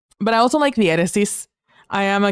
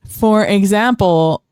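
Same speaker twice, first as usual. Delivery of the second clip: usual speaking voice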